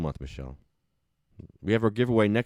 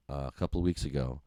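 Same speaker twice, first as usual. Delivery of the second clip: ordinary delivery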